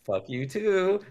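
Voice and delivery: high-pitched playful comeback; cheeky retold retort. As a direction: toss the insult back in a high voice.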